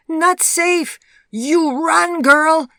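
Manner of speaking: high, very loud panicked warning; fearful shout. A narrator's reading. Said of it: "she shouted at Vivian".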